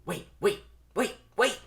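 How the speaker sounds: high, loud rapid-fire plea; urgent calling. Acted. Vanessa stops the bee from leaving, urgent. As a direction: stop someone before they go.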